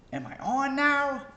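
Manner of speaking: high, loud eager question; loud child's voice. Acted. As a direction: boom out the question. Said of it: "always loud".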